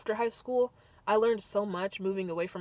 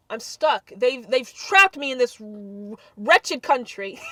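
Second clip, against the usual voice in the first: slow, louder, theatrical complaint; mock dramatic; 2 semitones higher, 7 dB louder, slower than usual. Real, not acted. To viewers about lockdown, slow hammed-up lament. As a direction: drag out the outrage, hamming it.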